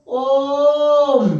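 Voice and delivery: ओम held long and loud as a pluta vowel; prolonged Om. Shown as a demonstration. Teacher showing students a three-beat ओम, drawn out. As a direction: chant ओम, stretching the vowel. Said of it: "यदि हम ओम कहेंगे तो इसमें एक".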